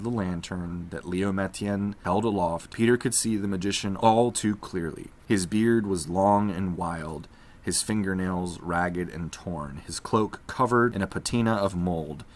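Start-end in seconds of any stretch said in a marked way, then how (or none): none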